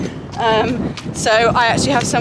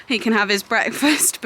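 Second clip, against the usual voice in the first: ordinary delivery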